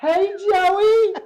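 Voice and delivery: high, loud imitation of an excited nurse; hammed-up shrieky greeting; calling out a greeting. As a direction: belt out an over-the-top hello.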